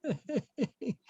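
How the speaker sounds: high wow through laughter; laughing wow. Real, not acted. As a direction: laugh while exclaiming. Laughing at proposal story.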